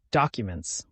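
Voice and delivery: usual speaking voice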